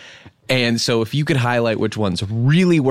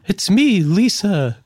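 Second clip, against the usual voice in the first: lightly raised lady voice; Lisa Murkowski; 7 semitones higher, slower than usual. Acted. Host shows his range with a higher lady voice. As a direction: nudge the pitch up a little for a woman senator. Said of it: "I show my range".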